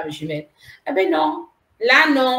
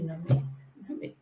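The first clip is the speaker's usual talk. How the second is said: whispered no; 4 semitones lower, 8 dB quieter than usual. Retold or imitated. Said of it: "Je dis".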